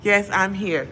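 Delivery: usual speaking voice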